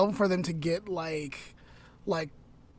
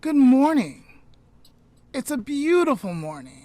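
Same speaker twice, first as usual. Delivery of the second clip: lightly voiced cheerful native man, louder start; a hint of a friendly Squanto saying good morning; Squanto greeting; 6 semitones higher, 6 dB louder than usual. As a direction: greet the settlers brightly as Squanto. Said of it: "Squanto said".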